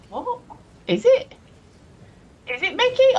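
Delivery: high, loud, incredulous double-check; doubting the claim with real surprise; surprised disbelief. Real, not acted.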